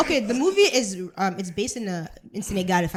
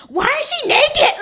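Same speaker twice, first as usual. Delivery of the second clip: high, loud imitation of shocked kids; mock childish outcry; kids screaming; 10 dB louder than usual. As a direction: shriek it like a scandalized classroom, drawn out. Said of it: "if you hear kids screaming".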